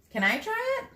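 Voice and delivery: put-on high, eager little boy asking; a kid itching to get his hands on it. Andres asking Gino for the cube, high and eager. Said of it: "Andres twisted it".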